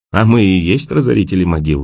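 usual speaking voice

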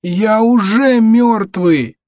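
put-on high, drawn-out zombie child; slow, high undead boy's retort; retorting son. A narrator's reading. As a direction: wail it slowly in protest.

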